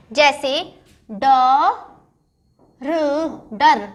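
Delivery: teacher stretching each syllable of डर; slow syllable reading. Shown as a demonstration. Teacher sounding out डर for children, slowly. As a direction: sound out ड, र, then blend डर slowly. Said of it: "ध्यान से सुनो मेरी बात को कि मैं कैसे पढ़ रही हूँ".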